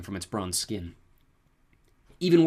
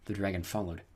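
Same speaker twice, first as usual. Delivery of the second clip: ordinary delivery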